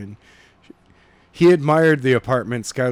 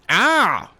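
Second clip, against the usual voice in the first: higher mock yelp of pain; a playful yelp after a punch; light hammed-up ouch; 7 semitones higher, 4 dB louder than usual. A narrator's reading. Punched in the arm.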